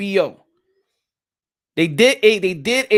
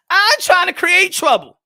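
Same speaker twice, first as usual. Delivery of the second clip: higher, louder insistence; emphatic denial; 8 semitones higher, 5 dB louder than usual. Real, not acted. Host denying he stirs trouble, loudly insistent. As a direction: protest it loudly, pitched right up. Repeats his denial with more force.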